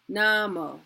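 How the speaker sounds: normal talking voice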